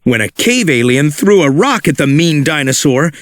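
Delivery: ordinary delivery, nothing marked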